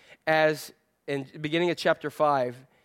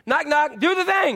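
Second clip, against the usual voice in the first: high, loud mock demand; hammed-up pushy knock; impatient commander; 8 semitones higher, 4 dB louder than usual. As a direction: rap on the door and insist, pitched way up. Naaman demanding at the door.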